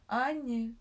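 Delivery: loud call from another room. A narrator's reading. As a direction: shout her name across the house. Эрик зовёт из кабинета. (громкий оклик из кабинета)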